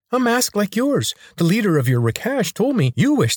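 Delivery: squeaky eager servant; servile high voice. A narrator's reading. Attendant answers master.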